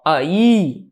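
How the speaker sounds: "Aí" as two syllables with a drawn-out í; separated hiatus. Shown as a demonstration. Teacher showing A separated from I, "Aí" as a-Í with long í. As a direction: split "Aí" and hold the final í. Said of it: "o A fica separado do I".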